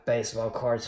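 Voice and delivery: ordinary talk, nothing marked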